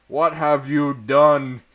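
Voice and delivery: loud, slow angry demand; a slow loud scolding question. A narrator's reading. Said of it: "Dumbledore walked in angrily".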